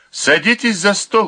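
sharp command to sit. A narrator's reading. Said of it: "приказал хозяевам Вялис".

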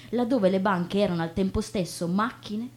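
usual speaking voice

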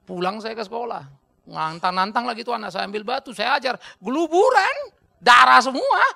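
ordinary talk

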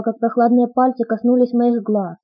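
ordinary delivery, nothing marked

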